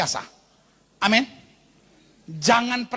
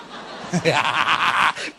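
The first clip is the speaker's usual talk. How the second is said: laugh; 5 semitones lower than usual. Real, not acted. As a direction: burst out laughing at your own joke. Laughs at own joke. (tertawa lepas)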